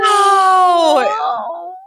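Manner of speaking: high, drawn-out melodramatic cry; Calculon. Retold or imitated. Co-host joins the overacting impression, melodramatic wail. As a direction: echo it with soap-opera overacting. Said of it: "Very over the top soap opera acting".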